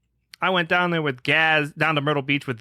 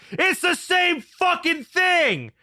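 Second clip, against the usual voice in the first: much higher, slow mock yell; imitating sister's yell; 14 semitones higher, much slower than usual. Mimicking the sister yelling about vegans, high slow mock yell. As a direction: drag out a shrill sister's rant. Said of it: "My sister fucking yells at me and she goes".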